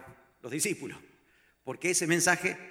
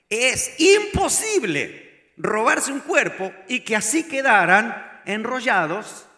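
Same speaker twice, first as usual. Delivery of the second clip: slow, louder, higher insistence; emphatic preaching; 5 semitones higher, 6 dB louder, slower than usual. Real, not acted. Preacher insisting to the congregation, slow and emphatic. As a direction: lean hard on imposible.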